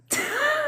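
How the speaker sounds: short high giggle; sudden squeak of laughter; quick laugh. Real, not acted. Coach retells a viewer liking it, quick high laugh.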